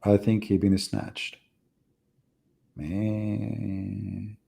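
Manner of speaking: ordinary talk, nothing marked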